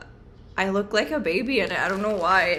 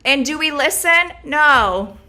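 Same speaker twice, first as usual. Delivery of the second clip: loud, high defiant answer; 6 semitones higher, 11 dB louder, slower than usual. Real, not acted. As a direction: shout the no proudly.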